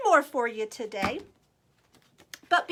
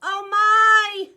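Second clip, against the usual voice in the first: plain reading voice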